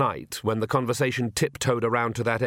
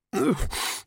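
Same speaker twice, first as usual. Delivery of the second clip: acted cough; 12 semitones higher than usual. Mightily Oates interrupting the naming, short acted cough. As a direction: cough briefly in character.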